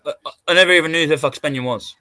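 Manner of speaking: normal talking voice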